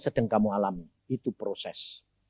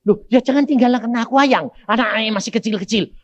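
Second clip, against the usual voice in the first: high, loud imploring; wife's desperate pleading; 8 semitones higher, 6 dB louder than usual. Retold or imitated. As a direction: beg your husband not to leave you. Wife begs dying husband to stay. (istri memohon dengan panik, suara tinggi)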